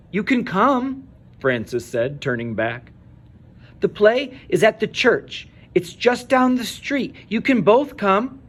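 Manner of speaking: put-on a little girl urging them to come; pleading child. A narrator's reading. Frances invites the organ grinder to the play, pleading.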